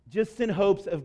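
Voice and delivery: ordinary delivery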